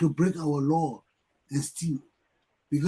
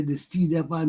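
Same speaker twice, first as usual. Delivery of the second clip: ordinary delivery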